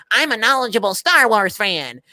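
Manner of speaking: lightly mocking nerd voice; put-on fanboy brag. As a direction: boast like a know-it-all fan, mockingly. Mocking a fan's boast.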